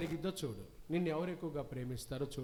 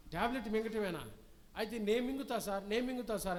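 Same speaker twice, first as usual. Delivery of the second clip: eager volunteering; 6 semitones higher than usual. Acted.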